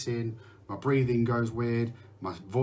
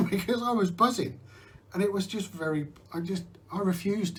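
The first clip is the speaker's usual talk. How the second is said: laughing; 9 semitones higher than usual. Real, not acted. Laughing about buying hourglass.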